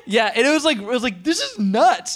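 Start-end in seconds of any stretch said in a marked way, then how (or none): 1.2-2.2 excited. Retold or imitated. Retold own reaction, high.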